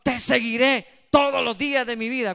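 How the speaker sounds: loud, emphatic vow to the Lord; decisive conviction. Acted. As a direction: proclaim it with full conviction. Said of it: "cuando hay decisión y convicción y podemos decir".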